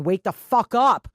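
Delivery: normal talking voice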